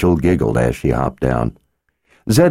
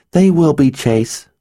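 put-on eager little girl; 10 semitones higher than usual. Girl answering her father, high eager promise. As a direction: promise it brightly like a keen child.

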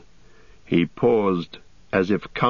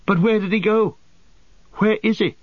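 high-pitched, urgent hobbit questioning; high, agitated young hobbit; anxious cry; 12 semitones higher, 3 dB louder than usual. A narrator's reading. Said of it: "cried Pippin".

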